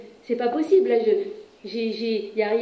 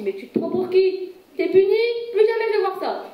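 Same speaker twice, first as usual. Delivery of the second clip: put-on parent snapping at a cheeky child, rising high and louder; mock scolding parent; 7 semitones higher, 3 dB louder than usual. Parent scolding a child who talks back, mock outraged. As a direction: scold like an offended parent, pitched up.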